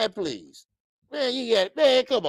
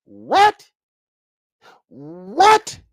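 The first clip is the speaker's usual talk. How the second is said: loud, disbelieving "What?"; outraged what; 8 dB louder than usual. Real, not acted. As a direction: shout it in shock.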